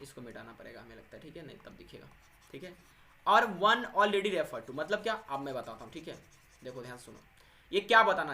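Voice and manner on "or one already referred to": slow, deliberate reading aloud from the book; slow textbook reading. Acted. Teacher reads the book's definition slowly to students. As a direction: read each word out slowly for the class.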